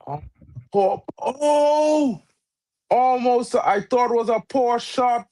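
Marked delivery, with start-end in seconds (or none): none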